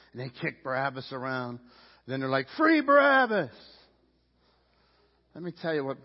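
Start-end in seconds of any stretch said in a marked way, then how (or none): 2.6-3.5 high, loud crowd chant; shouted demand, pitched well up; crowd's shout. Acted. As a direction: call out like a rallying mob. Acting out the crowd's cry.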